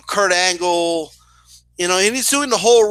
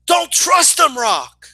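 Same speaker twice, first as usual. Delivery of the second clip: loud, high shout at a wrestler; mock frantic fan yelling out a warning; imitated marking out; 6 semitones higher, 3 dB louder, much slower than usual. Fan warning The Rock, marking out. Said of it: "this lady's marking out right now".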